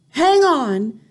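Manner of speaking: high, loud call to stop; Mildred's loud high cry; cried out. A narrator's reading. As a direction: cry out to halt everyone. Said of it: "cried the witch called Mildred".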